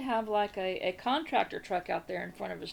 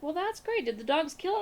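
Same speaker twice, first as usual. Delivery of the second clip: sarcastic neighbor; 7 semitones higher than usual. Retold or imitated. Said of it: "And he's like".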